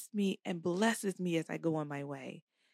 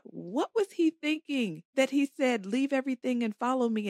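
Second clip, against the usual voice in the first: higher, baffled retelling; incredulous thought; 6 semitones higher, 4 dB louder than usual. Retold or imitated. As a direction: question it in disbelief, pitched up. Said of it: "and I've thought".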